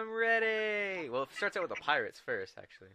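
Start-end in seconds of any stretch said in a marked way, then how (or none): 0.0-1.1 high, long-drawn cartoon cry; cartoon catchphrase. Quoting SpongeBob's first line to friends, high drawn-out cry.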